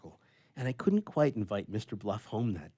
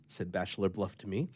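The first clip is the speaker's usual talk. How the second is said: plain reading voice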